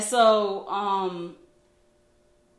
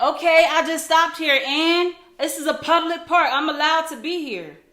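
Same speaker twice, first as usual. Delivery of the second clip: raised, higher mock indignant voice; defensive comeback; 6 semitones higher, 7 dB louder than usual. Man defends being at the park, imitated defensive retort. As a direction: imitate someone loudly standing their ground.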